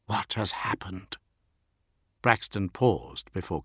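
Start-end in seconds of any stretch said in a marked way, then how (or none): 0.1-1.2 hushed, softer whispered question; hoarse whisper. A narrator's reading. Fulgrim fears for his brother Horus, hoarse whisper. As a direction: whisper it with dread. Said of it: "his voice hoarse".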